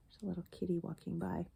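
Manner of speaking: plain reading voice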